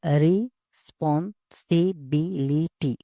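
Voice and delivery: "Responsibility" slowly in six separate beats; syllable by syllable. Shown as a demonstration. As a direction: break "responsibility" into its syllables with short gaps. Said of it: "six syllables है".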